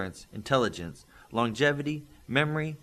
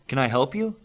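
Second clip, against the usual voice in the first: lightly voiced father, polite; a hint of a dad answering the door; 4 semitones higher, 6 dB louder than usual. Father greeting a visitor, lightly voiced.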